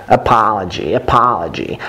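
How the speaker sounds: American model with open "ah"; American-accent pronunciation demo. Shown as a demonstration. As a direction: say it the American way, with an unrounded vowel. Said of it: "American is closer to".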